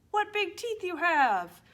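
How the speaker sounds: loud, high storybook amazement; astonished Little Red. Acted. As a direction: exclaim it in hammed-up wonder. Re-enacting Little Red's astonishment.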